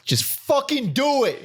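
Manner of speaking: high, slow mock yell; mock shout. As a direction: shout it like a pushy producer. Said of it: "Just shouting at us".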